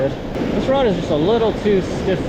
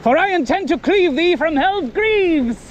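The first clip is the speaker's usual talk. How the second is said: knight voice; 7 semitones higher than usual. Pretend. Knight duel challenge.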